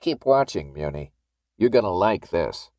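knowing menace, dropping low at the end; a touch of sinister relish; gleeful taunt. A narrator's reading. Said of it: "shone with something like glee".

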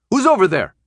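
high, loud challenge into the dark; shouting at an unseen figure in the dark; yelled. Acted. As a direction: call out across the street, daring them. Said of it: "Minho yelled".

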